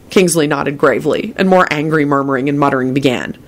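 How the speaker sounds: plain reading voice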